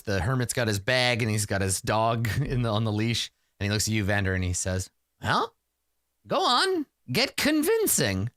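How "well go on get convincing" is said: high, drawn-out goading; high-pitched, impatient urging. Acted. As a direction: prod someone to get on with it. Hermit goads Vander into the church.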